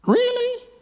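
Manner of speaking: squeaky high mock disbelief; high incredulous "really". Real, not acted. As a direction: jump way up in pitch, mocking disbelief. Mocking incredulous rhetorical question.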